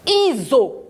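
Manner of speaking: "Iso" read as i-zo, voiced z. Shown as a demonstration. Teacher reads for children to listen, "Iso" with voiced z. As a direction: sound out "Iso" with a buzzing z. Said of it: "Mais moi, j'entends quoi?".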